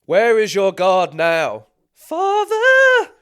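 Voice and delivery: booming slow posh bellow; Matt Berry impression. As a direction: roar it like a pompous posh villain. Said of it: "the posh man from I T Crowd".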